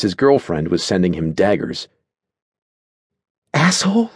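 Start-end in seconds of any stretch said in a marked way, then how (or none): 3.5-4.1 high, rising, affronted question; mutinous. A narrator's reading. Natasha challenges her boyfriend's insult, mutinously. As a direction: throw the insult back mutinously. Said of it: "muttered mutinously".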